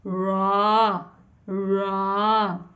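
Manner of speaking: ra with rounded tongue and open mouth. Shown as a demonstration. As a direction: curl the tongue for रा. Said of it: "कैसे?".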